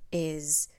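ordinary delivery

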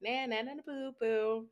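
taunting. Retold or imitated. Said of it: "rub it in my dad's face and be like".

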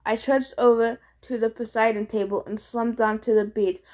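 usual speaking voice

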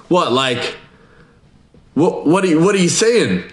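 high-pitched, stammering incredulous question; mock bewilderment. Acted listener, baffled by rumour.

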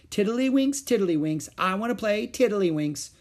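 high, singsong chattering. A narrator's reading. Little girl chattering at her sister to play, singsong. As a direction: bounce it out like a pestering child. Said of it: "chattered Ramona".

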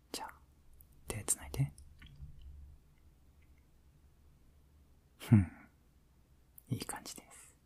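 soft sleepy whisper; pillow talk. Acted. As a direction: whisper tenderly to drift off.

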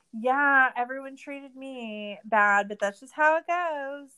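high, loud, slow, dismissive sing-song; mocking whiny quote. Host mocking Tanya's self-pity for podcast listeners, high mocking whine. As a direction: mimic her shrugging off blame, sarcastically. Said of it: "She's saying it like".

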